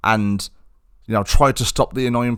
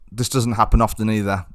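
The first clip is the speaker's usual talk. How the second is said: ordinary delivery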